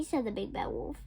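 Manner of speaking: ordinary delivery, nothing marked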